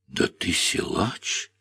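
slow, breathy, hushed wonder; marvelling. Acted. Girl marvelling after he carries her, slow and hushed. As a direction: marvel at him softly and slowly. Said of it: "подивилась Анфиса".

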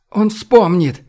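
high, impassioned shout; crying out. A narrator's reading. Olga insists to Boris that he will remember, crying out. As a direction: cry it out, pitch leaping up. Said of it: "крикнула Ольга".

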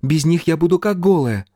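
put-on high, stubborn girlish voice; petulant protest. A narrator's reading. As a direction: protest like someone stamping a foot. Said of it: "топнула ножкой Птраси".

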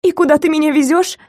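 high, scared and urgent; frightened question. Acted. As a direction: demand it in fear. Frightened hostage asks.

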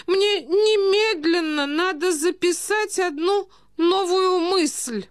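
very high, plaintive aristocrat; distressed old prince. A narrator's reading. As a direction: beg to be taken away, helpless and appalled. (старый князь, высокий жалобный голос)

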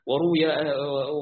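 ordinary talk, nothing marked

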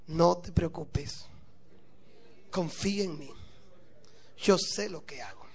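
slow, low, soft and serene; calm her fears gently, almost whispering; calm reassurance. Acted. Husband's calm reassuring refrain.

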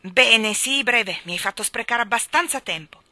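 loud, curt royal annoyance; king angrily telling the cat to be brief; impatient anger. Acted. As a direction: snap at the cat to hurry. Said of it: "scrollò rabbiosamente le spalle".

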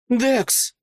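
high, urgent call; cried-out name. A narrator's reading. Deborah on the table calls out desperately to her brother. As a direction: call the name with pitch leaping up. Deborah cries out a second time.

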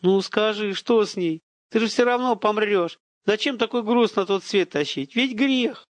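high, tearful pleading; high-pitched despairing entreaty; almost crying. A narrator's reading. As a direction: implore him, close to tears. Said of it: "тот уже почти плачет".

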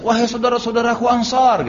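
high, carrying call to comrades; retold shout. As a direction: cry out to rally your brothers. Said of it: "Bilal teriak".

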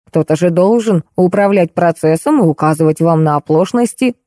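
ordinary delivery

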